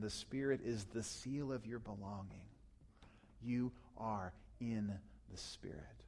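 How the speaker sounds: whispered, soft and low, "You are in the Spirit."; hushed whisper. Acted. Pastor to congregation, closing the point in a whisper. Pastor softly repeating key truth.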